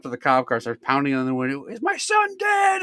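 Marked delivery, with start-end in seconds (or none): 1.6-2.8 very high, slow imitated scream; panicked mom screaming. Mom pounding on cop car window, imitated panicked scream. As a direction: wail it like a hammed-up frantic mother. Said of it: "and screaming".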